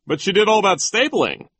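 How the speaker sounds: high, loud teacher's objection; protesting. A narrator's reading. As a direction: protest on her behalf. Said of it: "Mister Remora protested".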